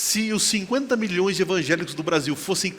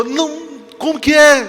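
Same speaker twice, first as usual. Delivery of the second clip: high, loud, startled query; acted startled question; 10 semitones higher, 11 dB louder than usual. Woman questions the voice in her dream, acted startled question. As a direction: ask the voice to repeat, taken aback.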